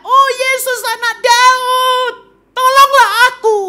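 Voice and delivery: very high, drawn-out cry for help; desperate shout. Acted. Blind Bartimaeus calling Jesus for help, shouting at full voice. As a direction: holler it slowly across a crowd. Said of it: "dia teriak".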